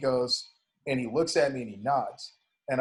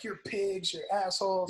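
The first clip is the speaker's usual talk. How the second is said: mock screaming; 7 semitones higher, 3 dB quieter than usual. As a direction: imitate a protester screaming at cops, held back. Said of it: "start screaming at the cops".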